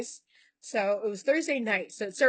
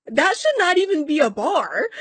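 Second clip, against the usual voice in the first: loud, high retold outrage; imitating a doctor's loud, high-pitched protest; emphatic disbelief; 7 semitones higher, 10 dB louder than usual. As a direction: act out an appalled doctor, punching it out. Doctor's emphatic reaction acted out.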